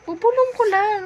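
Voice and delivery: loud, high-pitched plea; insistent coaxing. Real, not acted. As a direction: coax loudly, pitched well up. Coaxing an unwilling listener to hear gossip.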